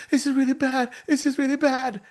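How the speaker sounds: high, put-on distressed voice; mock panic. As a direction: play at despairing over a setback. Acts someone hitting a roadblock.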